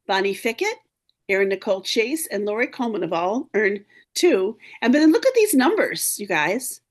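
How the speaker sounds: ordinary talk, nothing marked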